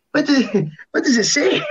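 high, laughing panicky question; mock panicky question, half laughing. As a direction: play the sudden dread, pitched high. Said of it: "new wave of panic".

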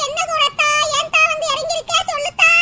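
ordinary talk

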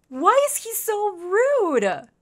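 slow high-pitched sulky wail; hurt whiny complaint. Acted. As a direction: wail it like a wounded little creature. Hurt complaint about Oscar.

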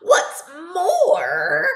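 sharp, loud, high snap; snapping Lorax. Acted. Lorax with his dander up, snapping loud. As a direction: snap it out in anger. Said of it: "snapped the Lorax".

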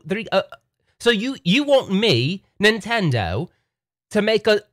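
high, haughty British voice; British accent. Pretend. Nintendo talking down to Arcade One Up, haughty British accent. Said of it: "Nintendo has a British accent".

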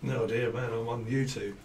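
understated mock cockney; cockney accent. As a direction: slip into quiet cockney. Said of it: "I start speaking like a cockney".

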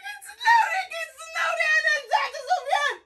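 high, sobbing outburst with broken laughs; crying shout. Acted. Sister wails at her brother that her friends are waiting. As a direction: wail it between sobs and shouts.

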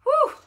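very high, loud whoop; excited whoop. Real, not acted. Host whooping after announcing the episode, excited whoop. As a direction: let out a sharp, high celebratory cry.

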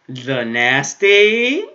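slow drawn-out rising question; mock scandalized. Shown as a demonstration. Repeating the anime line in a slow teasing drawl. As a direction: stretch it out, teasing. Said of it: "I'm joking".

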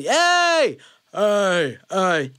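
loud yell running out of air; yell without breath. Shown as a demonstration. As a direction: push out yells on empty lungs, fading. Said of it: "loud as I can".

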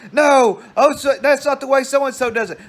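high, loud mock yell; kid arguing back. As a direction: imitate a boy shouting back at his mother. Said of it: "And then he would".

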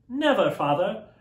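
put-on children answering their father; children crying out. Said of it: "they all cried".

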